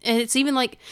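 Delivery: ordinary delivery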